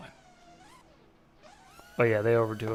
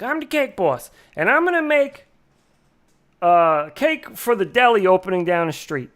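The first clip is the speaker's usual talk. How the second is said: mock Italian-American TV baker accent, pitched up; Cake Boss; 4 semitones higher, 4 dB louder than usual. Imitating a TV baker announcing a deli cake, fake Italian accent. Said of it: "He talked with a really fake accent".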